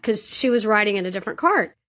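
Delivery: ordinary talk, nothing marked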